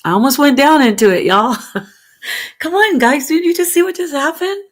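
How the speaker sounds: high, loud excited exclamation; excited outburst. Real, not acted. Excited streamer shouting to viewers about her near slip. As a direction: caught herself and shouts to the chat.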